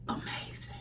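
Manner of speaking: breathless whisper. Real, not acted. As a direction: let the word slip out as a whisper. Overcome with emotion, whispered.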